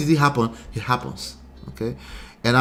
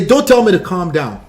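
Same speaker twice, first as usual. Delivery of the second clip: higher, louder mock indignant snap; 5 semitones higher, 8 dB louder than usual. Upset partner refusing to be calmed, mock snap. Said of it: "you will say".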